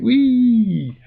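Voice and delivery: long, high, loud whee; gleeful whee. Retold or imitated. Reviewer mimicking a ride through the time vortex, gleeful squeal.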